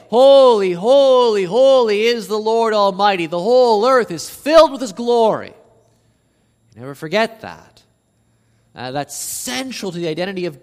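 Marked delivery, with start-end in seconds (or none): 0.1-5.5 loud, high, ringing holy holy holy; seraphim's cry. Acted. Preacher voicing the seraphim to congregation, loud and high. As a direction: cry it out like angels in worship.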